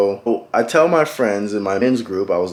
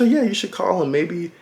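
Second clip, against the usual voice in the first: mother's advice; 8 semitones higher than usual. Retold or imitated.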